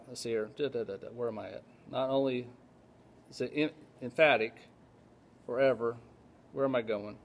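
low, soft self-talk; muttering to himself. Real, not acted. Preacher looking for the paragraph, muttering to himself. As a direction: mumble absently while searching. Hunting for his place in notes.

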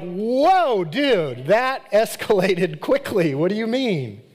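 high, loud mock surprised voice; shocked mother. Saul's wife overhearing the insult, mock shocked. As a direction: gasp it out like a startled queen. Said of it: "And she's like".

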